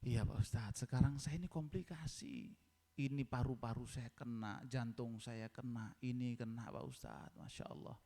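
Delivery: low, soft, winded sick man; acted weakness fading to a voiceless breath; imitated breathlessness. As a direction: list the ailments as if short of breath. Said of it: "Sambil nafasnya terengah-engah".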